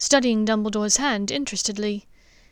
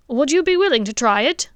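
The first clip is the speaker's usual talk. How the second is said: higher, louder hopeful question; father asks the headmaster to let him try; eager dentist asks; 4 semitones higher, 6 dB louder than usual. Acted. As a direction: lean in and ask hopefully.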